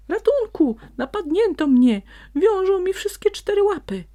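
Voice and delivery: high, loud panicked yelling; fox screaming for help. A narrator's reading. Tied-up fox screams for help, panicked. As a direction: scream while struggling in the ropes. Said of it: "krzyczał, szamocąc się".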